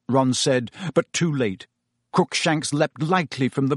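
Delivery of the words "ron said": plain reading voice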